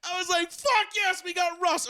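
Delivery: joyful shout. Retold or imitated. Broncos fan hearing of the trade, joyful laughing shout. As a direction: scream it with delight. Thrilled at the Russell Wilson trade.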